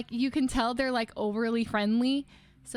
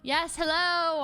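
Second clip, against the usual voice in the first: higher, drawn-out polite hello; matching enthusiasm; 5 semitones higher, much slower than usual. Retold or imitated. Customer answering the excited bank man, matching enthusiasm. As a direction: play along with an eager greeter. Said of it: "I was matching his enthusiasm".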